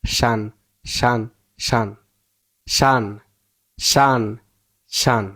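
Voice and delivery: শান as a short, unstressed "shan"; "shan" ending. Shown as a demonstration. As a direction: drill শান in separate, falling repeats. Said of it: "উচ্চারণ করতে হবে". (শান, নামানো সুরে আলাদা করে)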